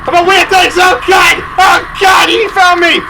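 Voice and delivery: hammed-up fright. Gamer caught by the smiley monster, mock fright. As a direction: cry out that it found you.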